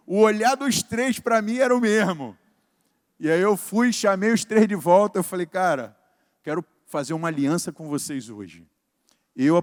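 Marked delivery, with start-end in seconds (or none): none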